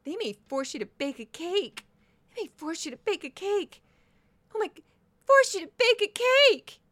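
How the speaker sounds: high, mocking parody of a dramatic pundit; mocking impression. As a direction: ham up her outrage, rising to a squeal. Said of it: "she went a lot of places with that".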